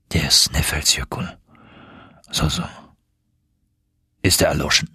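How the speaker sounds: low-pitched, guarded interest; musing. Acted. Uncle feigns casual interest in volcano.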